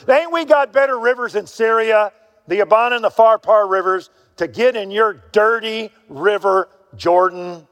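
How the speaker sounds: high, loud, offended ranting; a proud general insulted by the prophet's order, shouting; indignant outburst. Acted. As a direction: scoff at the dirty foreign river. Naaman indignant at river order.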